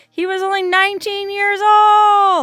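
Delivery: high, loud wail of dismay; dismayed outcry. Real, not acted. Vlogger reading a memorial to a dead teenager, dismayed outcry. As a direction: cry out, drawing it out high. Shocked reading a rider's age.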